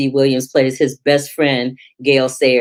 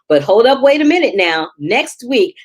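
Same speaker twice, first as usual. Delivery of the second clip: higher and louder hype call; excited teaser; 7 semitones higher, 3 dB louder than usual. Real, not acted. Host hypes next week's lineup to her viewers, excited. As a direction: stop them in their tracks with the big news.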